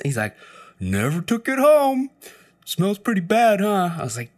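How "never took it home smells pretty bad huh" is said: slow, high stoner drawl, pleased with himself; high lazy stoner voice; stoner coworker voice. Retold or imitated.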